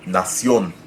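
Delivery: nación with the final CIÓN stressed; Spanish nación, stressed on the Ó; stress on last syllable. Shown as a demonstration. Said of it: "the stress is on the last syllable".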